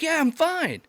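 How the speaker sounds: very high-pitched quoted reassurance; imitated buddy. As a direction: mimic a hurt friend insisting all is well. Hurt buddy brushes off concern.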